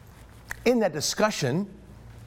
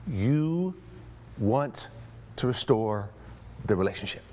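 word by word, with pauses, stepping lower; deliberate emphasis; 5 semitones lower, 4 dB quieter, slower than usual. Real, not acted. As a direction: drive the point home slowly, each word set apart. Preacher drives home key point.

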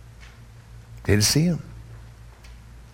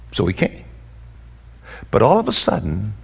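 ordinary delivery

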